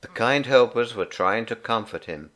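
ordinary delivery

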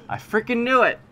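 high, loud burst of frustration; frustrated yell. Real, not acted. Caught by enemies he expected, a high frustrated yell. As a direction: shout it like you called it.

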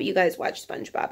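unvoiced whisper to herself; whispered aside. Real, not acted. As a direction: breathe it as a secret aside.

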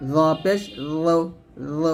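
thick, rounded জ; heavy lisped Z. Shown as a demonstration. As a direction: thicken each জ. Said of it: "এরকম মোটো করে". (মোটা করে জ)

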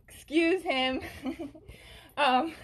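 high, loud words breaking into giggles; laughing apology. Real, not acted. Host excuses her friend Cooper to viewers, laughing. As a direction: apologize through real laughter.

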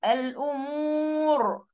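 الأمور drawn out slowly into a thick, heavy R. Shown as a demonstration. Teacher modelling the word for students, heavy ra in الأمور. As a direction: stretch the vowel in الأمور and end on a full ر. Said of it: "مفخمة".

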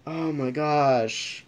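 ordinary delivery

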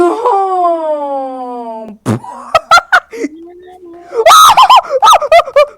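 long howling laugh; long loud laughing fit. Real, not acted. Laughing at the spin.

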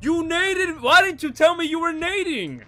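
high, loud accusing complaint; indignant outburst. Real, not acted. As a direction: blame the teammate, loud and high. Teammate grenaded him.

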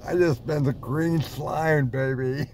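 usual speaking voice

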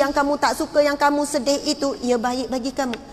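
ordinary delivery

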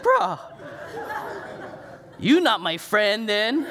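high, laughing mock protest; mock hurt. Husband protesting to his wife, laughing mock hurt. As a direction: feign hurt through a laugh. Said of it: "I'm like".

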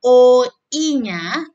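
O I-nya stretched slowly and loudly. Shown as a demonstration. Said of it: "bibir Anda menjadi lebar kembali". (O I-nya diucapkan pelan dan keras)

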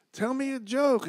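high-pitched mock female voice; imitated woman. Woman at the club asks comic for a joke, high imitation. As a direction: put on a high voice for her. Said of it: "this chick was like".

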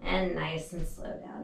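strained whisper while doing sit-ups; breathy whisper mid rep. Real, not acted.

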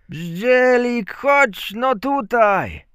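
loud, high, drawn-out call; father calling out. A narrator's reading. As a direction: summon a child from across the field. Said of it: "zawołał ojciec". (głośne, przeciągłe wołanie ojca)

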